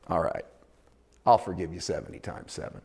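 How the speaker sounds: a patient God quietly forgiving again; gentle forgiving God. Acted. God reassuring a repeat offender, soft and low.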